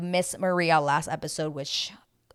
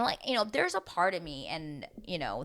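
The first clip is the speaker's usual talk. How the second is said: ordinary delivery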